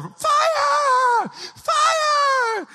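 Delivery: very high, long screams; little girl yelling. Retold or imitated. Four-year-old yelling out the window, shrill play scream. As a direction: shriek it like a small child. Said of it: "Little four-year-old".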